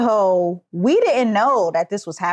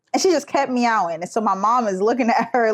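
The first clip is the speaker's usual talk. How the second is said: ordinary delivery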